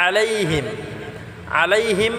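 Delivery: "আলাইহিম" with pressed first vowel and short ending; "আলাইহিম" with Arabic ain, unstretched him; throaty ain, short him. Shown as a demonstration. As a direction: squeeze the start of "আলাইহিম", keep him short. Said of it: "আইনটাকে গলায় চাপ দিয়ে".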